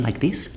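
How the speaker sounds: "like this" lifted like a New Zealand question; rising end. Shown as a demonstration. Comic showing a New Zealand accent, "like this" turned upward. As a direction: let "like this" go up at the end. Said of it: "It always goes up at the end".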